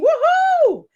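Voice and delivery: high joyful woohoo; excited whoop. Real, not acted. Host cheering her own song to the chat, a high whoop. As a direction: let out a happy whoop.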